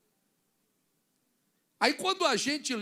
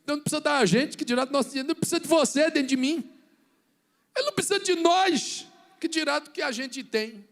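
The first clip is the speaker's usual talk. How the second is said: loud, higher fervent sermon; preaching shout; 5 semitones higher, 10 dB louder, faster than usual. Real, not acted. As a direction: shout it with fervor. Pregação em voz forte. (grito de pregador, agudo e forte)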